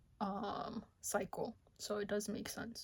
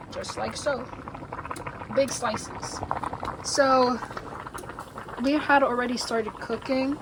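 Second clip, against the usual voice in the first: louder, upbeat presenter voice; cooking show host; 12 dB louder than usual. Acted. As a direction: sell the menu to the viewers.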